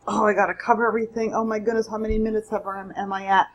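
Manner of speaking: fast, mildly flustered inner voice; rushed self-talk. Retold or imitated. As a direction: hurry the words, a touch of mock panic. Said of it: "I'm like".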